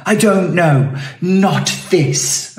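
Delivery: high-pitched mocking petulance; mock childish protest. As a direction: answer like a sulky child, hammed up. Mocking an immature protester's reply.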